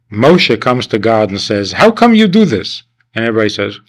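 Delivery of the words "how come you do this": much higher, pleading demand; indignant protest. Retold or imitated. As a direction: ask God like a wronged child. Moshe protesting to God.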